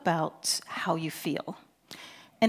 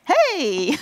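mock-excited greeting, higher and louder; match the caller's excitement with a bright yell; hammed-up excited hey back to Paul; 7 semitones higher, 10 dB louder than usual. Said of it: "I just resonated with his excitement".

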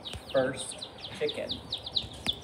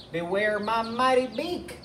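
higher-pitched little hen boast; defiant chicken; 6 semitones higher than usual. Acted. Edna the chicken answers Tyrannosaurus, high defiant voice. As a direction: throw the threat back in a high voice.

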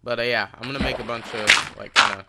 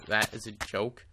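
ordinary talk

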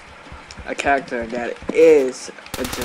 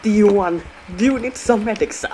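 higher, louder hammed-up German medic voice; mock German medic; 6 semitones higher, 5 dB louder, faster than usual. Offering heals to other players as the Medic, hammed-up German accent. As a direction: play the TF2 Medic offering heals to strangers. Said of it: "It's me, the medic".

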